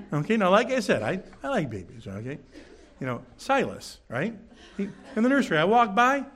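ordinary talk

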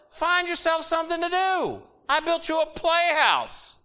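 shrill-free high-pitched imitation of a nagging mother; high mock mom scold. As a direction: mimic mom shooing the kids out, way up high.

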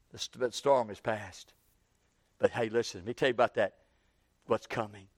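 low, soft, intimate reading; hushed and tender. Acted. Preacher softly reading the bridegroom's call to his church, hushed. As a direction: draw them in close and gentle. Preacher quietly recites the verse.

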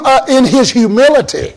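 usual speaking voice

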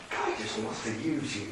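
very soft, low mock preacher; hushed, low feel-good parody; Joel Osteen. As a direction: murmur it as a smiley preacher parody. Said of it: "A man that talks like that".

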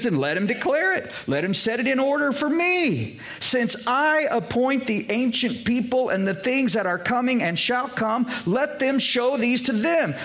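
preaching at full force. Acted. Pastor proclaims Isaiah's verses to his church, ringing preaching. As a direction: lift the voice and proclaim like a pulpit preacher.

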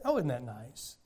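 low, soft and sarcastic "Oh, isn't that nice?"; sarcastic mockery. Preacher to congregation, mocking the bland ad, low soft sarcasm.

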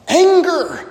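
sudden high exclamation of the word; exclaimed. Real, not acted. Preacher to congregation, exclaiming the word. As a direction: fling out the word Anger high and sharp.

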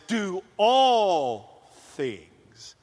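stretched very slowly; slow solemn quote. Retold or imitated. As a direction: drag each word out with weight. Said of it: "God says".

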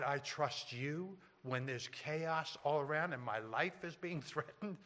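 softly voiced mock disbelief; incredulous protest. Dying believer questioning God's command to forgive, hushed disbelief. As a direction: question it quietly, as if it were absurd.

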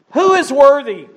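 high ringing preacher's cry; soaring sermon proclamation; preaching at full force. Acted. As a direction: lift it up like the angel's call through heaven. Preaching builds to angel's cry.

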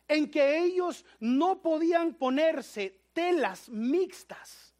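high, loud, forceful preaching. Acted. Pastor preaching hard to the brethren about the Pharisees. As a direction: drive the point home to the church.

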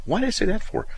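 high puzzled wife voice; lightly voiced wife; imitating wife. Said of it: "and then went".

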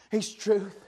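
quiet reverent "he's truth"; hushed. Real, not acted. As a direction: say it softly. Quiet then shouted repeat.

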